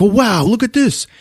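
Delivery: high-pitched mock wonder; awed excitement. Doctor spots the crystal on display, mock high-pitched amazement. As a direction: imitate a wowed visitor, pitch way up. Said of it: "the doctor sees the crystal".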